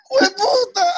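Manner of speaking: high, drawn-out imitated shriek; startled mock curse. Mom startled by husband beside her, mock shriek.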